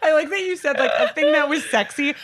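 shrieking laugh, high. Real, not acted. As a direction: crack up while talking. Cracking up at friend's line.

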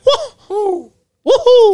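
very high falsetto whoops; Mario. Acted. Host auditions for the part, gleeful high whoops. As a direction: squeal it like a jumping game hero. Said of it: "I can do that".

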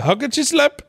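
very high, loud Indian put-on; mock Indian accent. Friend repeating the line for laughs, mock Indian accent. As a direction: pitch it way up, hammed-up Indian accent.

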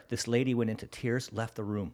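normal talking voice

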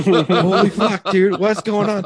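a laughing yelp of pretend terror; mock scared. Cop retelling the massage, high laughing mock panic. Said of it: "I was fucking scared".

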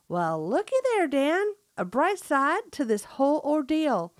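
hammed-up country accent; best country accent. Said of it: "That was my best country accent".

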